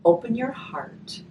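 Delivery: gentle storybook reading, slow and warm; reading to children. A narrator's reading. Teacher reading a grief picture book to children, gentle storytelling.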